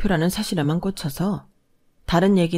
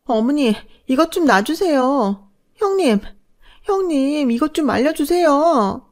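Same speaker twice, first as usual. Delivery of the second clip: high, louder desperate pleading; screaming for help; 9 semitones higher, 4 dB louder than usual. Acted. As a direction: cry out while your hair is pulled. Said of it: "소리를 질렀어요".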